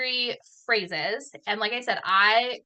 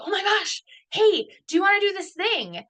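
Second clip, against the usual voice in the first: high-pitched gushing invitation, acted out; mock-excited squeal of an offer; 10 semitones higher, 3 dB louder than usual. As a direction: mimic someone bursting with enthusiasm. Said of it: "Somebody's very excited about it".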